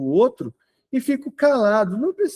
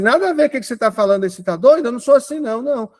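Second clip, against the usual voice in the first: quick, higher-pitched indignant comeback; mock defensive retort; 4 semitones higher, much faster than usual. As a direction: brush off criticism as if it were crazy. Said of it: "Eu não falo assim". (resposta defensiva, indignada e apressada)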